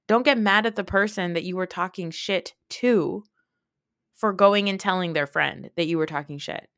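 ordinary delivery

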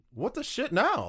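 high-pitched mock dismay; exasperated disbelief. Player reliving the moment his team traded away the pick, exasperated disbelief.

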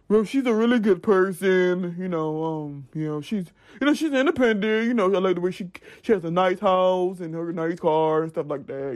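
put-on low man voice. Woman guessing what boyfriend would say about her, mock man voice. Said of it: "that's like my man voice".

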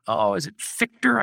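usual speaking voice

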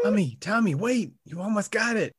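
high, eager coaching; excited kid. Pretend. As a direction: cheer him on like a kid.

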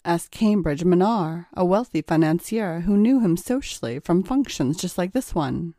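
plain reading voice

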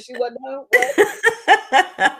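slow, stretched, laughing what; drawn-out shocked disbelief; surprised disbelief. Retold or imitated. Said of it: "I said".

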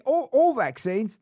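high, slow, loud British attempt; badly faked British ad-mascot accent; Geico guy. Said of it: "sound like the Geico guy".

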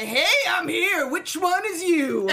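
put-on high, slow, loud drunk entrance; hammed-up tipsy arrival, drawn out; drunk announcement.

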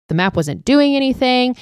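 mock scream, pitched high; retold screaming back. Mike answers the others' yelling about the map, mock screaming. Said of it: "Mike screaming back".

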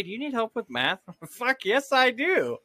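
ordinary delivery

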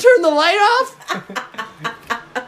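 high, drawn-out, hammed-up bewilderment; man gone blind. Joker acting blinded by the sun, high slow mock bewilderment. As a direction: call out slowly like you suddenly can't see. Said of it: "Slowly going blind because".

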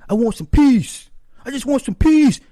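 high, loud, strained plea for peace; a grown man whining to be left alone; whiny irate man. Retold or imitated. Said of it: "He's like".

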